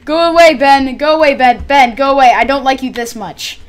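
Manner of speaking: high, loud yell at Ben; shouting. Real, not acted. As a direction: shout Ben away from the diamonds. Shouting villager away.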